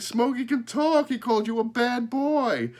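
put-on high, loud amazed girl; thrilled high-pitched exclamation; excited cry. A narrator's reading. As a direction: blurt the astonishing news, high and loud. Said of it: "she cried".

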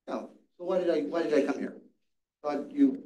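ordinary talk

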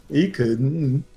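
put-on Norwegian dialect accent; exaggerated Norwegian-accented repeat; Canute's accent. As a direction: mimic the Norwegian accent, drawing out the end. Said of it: "saying it like Canute says it".

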